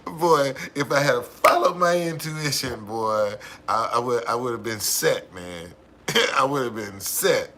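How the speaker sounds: loud, worked-up exclaiming between laughs; laughing regret. Real, not acted. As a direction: exclaim loudly, laughing at your own missed luck. Laughing over missed winning number.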